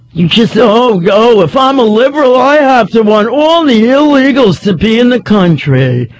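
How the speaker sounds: low-pitched, sarcastic mimicry; mocking paraphrase. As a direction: parody the caller's argument with scorn. Host mocks the caller's position.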